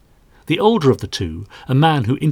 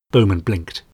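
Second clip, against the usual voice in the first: plain reading voice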